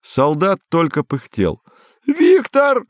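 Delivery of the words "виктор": very high, thin panicked call; high-pitched wail for help; distant wail. A narrator's reading. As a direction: cry out as if from far away. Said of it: "вопил Квадриго уже где-то вдалеке".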